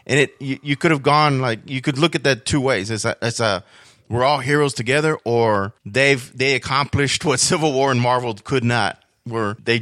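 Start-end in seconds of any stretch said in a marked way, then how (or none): none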